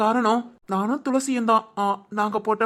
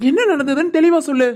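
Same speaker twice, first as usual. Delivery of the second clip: louder, higher urgent demand; 5 semitones higher, 5 dB louder than usual. Acted. Groom urging the bride to explain clearly, loud and urgent. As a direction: press her hard for answers.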